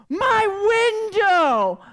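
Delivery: high, drawn-out, anguished holler; furious yell. Retold or imitated. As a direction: bellow it slowly in outrage. Acted yell at roommate.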